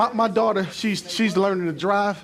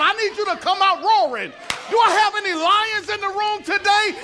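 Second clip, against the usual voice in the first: full-force preaching; 8 semitones higher than usual. Acted.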